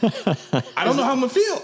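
loud, high-pitched laughing protest; laughing hard. Real, not acted. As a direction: crack up while talking, voice shooting up. Cracking up at own accent bit.

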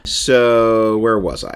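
ordinary delivery

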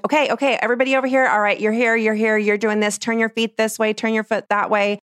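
high, lively group directions; directing tone of voice. Shown as a demonstration. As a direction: round everyone up briskly. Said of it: "they're used to this tone of voice".

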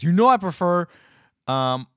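acted-out outburst over asparagus; retold yelling. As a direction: yell it as the annoyed man in the story. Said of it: "he immediately yells at her".

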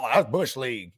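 mocking imitation. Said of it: "he's like".

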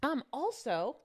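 talking while crunching ice; chewing ice between words. Real, not acted. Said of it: "sorry, I'm chewing ice here".

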